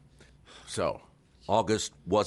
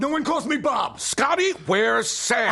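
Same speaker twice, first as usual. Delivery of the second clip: angry shout; 8 dB louder, slower than usual. Acted. Father barging in, demanding Sam.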